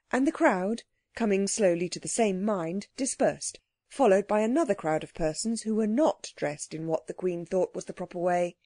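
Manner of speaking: plain reading voice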